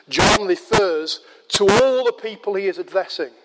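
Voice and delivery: ordinary talk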